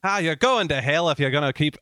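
Irish priest's telling-off, put on; attempted Irish accent. Shown as a demonstration. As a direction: scold in your best amateur Irish. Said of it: "oh, that's pretty good".

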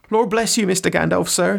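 high-pitched, humble gardener; flustered servant. Acted. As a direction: plead innocence in a high rustic voice. Reads Sam's dialogue aloud.

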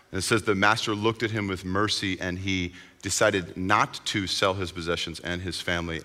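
usual speaking voice

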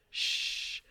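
long hushed shush; retold shush. She shushes him at the front door, hushed shush. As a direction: shush someone in secret. Retelling a character shushing someone.

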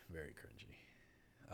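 hushed whispered aside. Real, not acted. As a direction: drop to a slow whisper. Whispered repeat of cringy.

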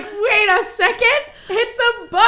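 high, loud realization; sudden realization. Retold or imitated. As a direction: catch yourself, high and loud. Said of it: "And I'm like".

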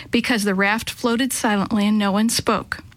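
usual speaking voice

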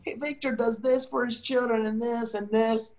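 high, prodding wife voice; nagging wife. Retold or imitated. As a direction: needle him with praise of another. Wife praising Victor, retold.

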